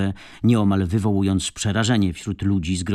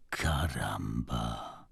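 very slow, low, hushed exclamation; drawn-out, shaken murmur; shocked whisper; 9 semitones lower, 11 dB quieter, much slower than usual. A narrator's reading. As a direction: breathe it out low and stunned. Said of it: "szepnął Miguel".

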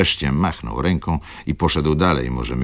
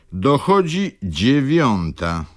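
slow, pointed "Dochodzi dziewiąta"; with special emphasis; 8 semitones higher, slower than usual. Acted. Old clerk corrects the hour, slow and pointed. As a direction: lean hard on each word of "Dochodzi dziewiąta". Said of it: "ze szczególnym naciskiem".

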